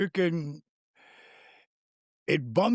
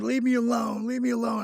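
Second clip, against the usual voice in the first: sleepy wife; 9 semitones higher than usual. Retold or imitated. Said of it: "She said".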